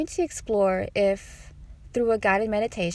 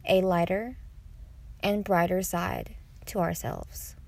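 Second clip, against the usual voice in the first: guided meditation. Acted. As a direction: invite listeners gently into meditation.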